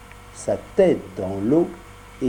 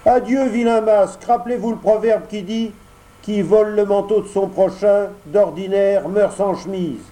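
high, louder puppet voice, scornful; Pinocchio refusing; 8 semitones higher, 3 dB louder than usual. Acted. Pinocchio scornfully rebuffs the fox and cat. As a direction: send the swindlers packing.

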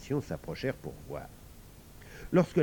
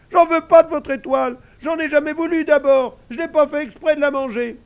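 very high, loud squealing; shrill, vexed piglet voice; sulky piglet; 14 dB louder than usual. Acted. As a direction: squeal it petulantly like a little pig.

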